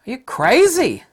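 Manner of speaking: a mock shriek of disbelief; incredulous outburst. At masked drivers, staring in loud high disbelief. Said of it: "I'm looking at 'em like".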